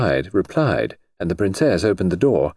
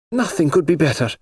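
cheerful declaration; 9 semitones higher than usual. Acted. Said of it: "she declared".

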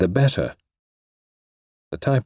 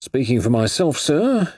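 lightly deferential, higher-pitched address; respectful; 6 semitones higher, slower than usual. A narrator's reading. Inspector to suspect after seeing papers, mildly respectful. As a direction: defer politely to the gentleman. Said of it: "he said respectfully".

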